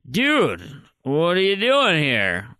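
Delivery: mock drunk Lincoln, slurred and loose; drunk slurring. Imitating a drunk guest telling history, slurred. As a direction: slur it like a wasted storyteller. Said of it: "they'll slur words like".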